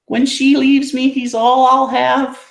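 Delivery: slow high cooing pet voice. Real, not acted. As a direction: drawl sweetly up high about the puppy. Cooing over dog, mock sad.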